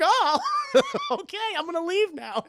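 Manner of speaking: high mock-meek voice breaking into laughter; giggly imitation of being cowed; flustered. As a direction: back down awkwardly, laughing through it. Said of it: "gets flustered".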